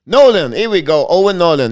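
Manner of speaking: loud, relieved "got it" excitement; excited recall. Real, not acted. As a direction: call out the name you were missing. Finally gets the missing name.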